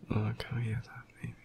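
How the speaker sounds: tender ASMR whisper, hesitant and apologetic; whispered intimate apology. Acted. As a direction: speak gently and close, with long pauses. Whispered apology to an upset partner.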